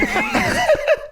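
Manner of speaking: very high squeal of laughter. Real, not acted. Laughing at the story.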